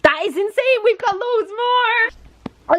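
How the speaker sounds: high, thrilled exclaim; gushing with excitement; excited. Real, not acted. Friend gushing over the winning scratch cards.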